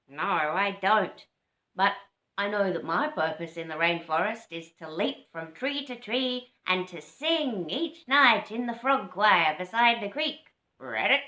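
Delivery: lightly croaky, proud frog; a touch of croak, chest out. Acted. Frog boasting about the frog choir, a touch of croak. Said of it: "recognising the croaky voice".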